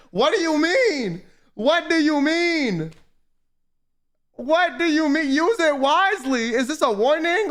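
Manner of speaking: high-pitched, incredulous demand; high, worked-up confusion; exasperated bewilderment. Real, not acted. Bewildered rant over cryptic message.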